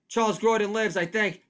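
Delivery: normal talking voice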